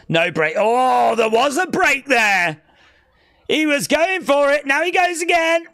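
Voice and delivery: loud, high, building play-by-play shout; excited wrestling commentary. Real, not acted. Streamer calling the wrestling match as he goes again, excited shout. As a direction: cry out the big move, pitch soaring.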